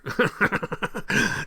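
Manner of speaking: a quick high laugh at his own joke. Real, not acted. Laughing to the chat at his own war joke, high laugh. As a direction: let a laugh burst out high.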